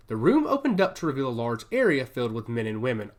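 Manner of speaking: ordinary delivery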